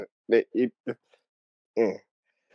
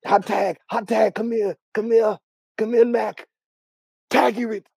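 frantic wrestler; 10 semitones higher, 4 dB louder than usual. Pretend. Acting a wrestler begging a tag.

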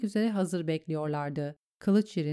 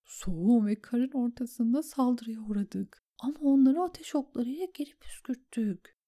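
higher, hushed, fading to a whisper; written message voice; 6 semitones higher than usual. A narrator's reading. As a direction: voice a dispatch under your breath. Sam writes battle message. (fısıltıya dönen not okuması)

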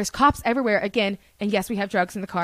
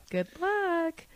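pitying good luck; 7 semitones higher, 9 dB quieter than usual. Retold or imitated. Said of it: "giving me that same look".

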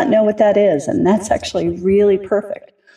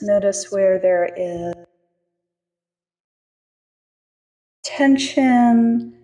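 calm grounding exercise. Acted. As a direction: ease them gently into their bodies, unhurried. Leads a grounding meditation.

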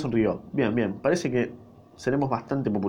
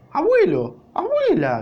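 much higher, louder joyful cry; shouting; 6 dB louder, slower than usual. A narrator's reading. Lustig shouts to his grandparents, high and loud. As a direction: cry out to long-lost grandparents. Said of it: "gritaba Lustig".

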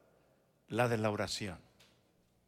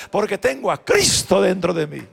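retold shout; 4 semitones higher, 11 dB louder than usual. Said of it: "le empecé a gritar".

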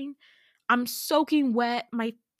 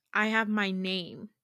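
ordinary delivery, nothing marked

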